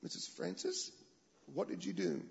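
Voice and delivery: hushed careful coaxing; whispered gentle question. Retold or imitated. Gentle asking after awkward silence.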